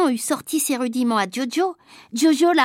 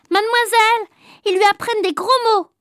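higher, fast whiny telling-on; tattling child; 8 semitones higher, 4 dB louder than usual. Acted. Agnan tattles to the teacher, high and quick. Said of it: "il a dit".

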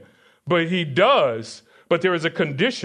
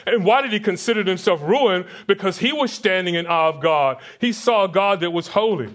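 ordinary talk, nothing marked

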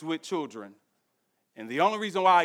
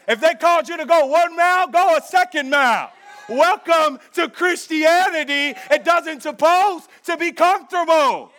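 loud, driving pulpit delivery; full-force preaching; 9 semitones higher, 9 dB louder than usual. Acted. Pastor driving the point home to his church, full force. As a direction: preach it hard to the congregation.

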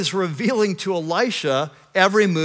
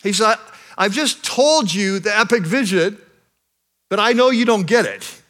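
louder, rising sermon build to a climax; preaching at full force; 5 semitones higher, 5 dB louder than usual. Acted. Pastor to his congregation, preaching at full force. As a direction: preach it, lifting the room.

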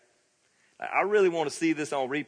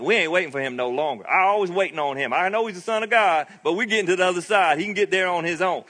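higher and louder, fed-up fisherman griping; impatient Peter, raised and louder; 5 semitones higher, 5 dB louder than usual. Retold or imitated. Peter griping to the crew, done waiting for Jesus, high and loud. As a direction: grumble like a tired rower done waiting on Jesus. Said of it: "I can imagine Peter down by the lake like".

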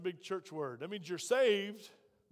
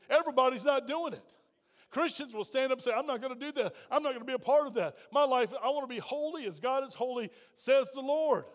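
higher, louder pulpit declaration; full-force preaching; 4 semitones higher, 5 dB louder than usual. Real, not acted. Preacher rebutting the crowd's excuse, high and forceful. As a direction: proclaim it with rising force to the last word.

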